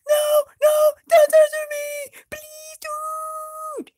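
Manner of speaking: high, loud pleading scream; terrified scream. Acted. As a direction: beg not to be tortured. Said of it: "Dolgodor screams".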